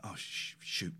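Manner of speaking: very soft, low retold reaction; acting out a stricken murmur; hushed dismay. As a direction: let it drop out quietly, as retold. Retells stricken response to friend.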